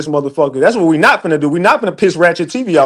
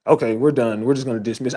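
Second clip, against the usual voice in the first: lower, much softer mock court voice; imitating the court; 8 semitones lower, 8 dB quieter than usual. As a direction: quietly voice the court giving up. Retells the court dropping case.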